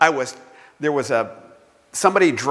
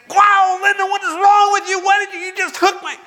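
hammed-up high, loud yell of a hurt dad; mock yelling; 4 dB louder than usual. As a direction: yell at the kid in exaggerated, high-pitched outrage. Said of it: "instead of yelling and saying".

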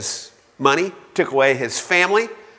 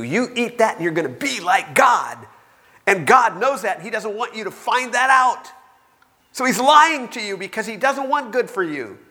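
insistent tempter; 7 semitones higher than usual. Acted. Satan urging Eve.

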